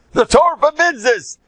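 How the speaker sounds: high, loud protest shout; high-pitched outraged yell; screaming. Retold or imitated. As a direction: bellow it out like a zealot at a wedding. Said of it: "start screaming".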